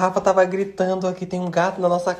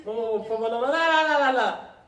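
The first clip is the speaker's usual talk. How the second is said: pleading; 5 semitones higher, faster than usual. Real, not acted. Pleading not to turn off.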